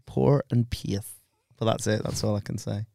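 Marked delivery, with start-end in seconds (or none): 0.0-1.1 slow, soft Northern Irish power and pace; Northern Irish commentator. Retold or imitated. Imitating a Northern Irish commentator for a friend. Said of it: "he always used to say".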